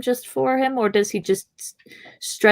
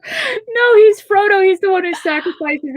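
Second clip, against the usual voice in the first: excited objection; 11 semitones higher, 5 dB louder than usual. Real, not acted. Emphatic correction in chat.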